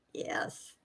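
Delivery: whisper. Real, not acted. Soft aside about beautiful Angel.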